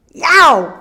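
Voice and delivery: loud, high yelp of pain; pained shout. A narrator's reading. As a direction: yelp as if you lost a tooth. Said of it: "shouted Cuchulain".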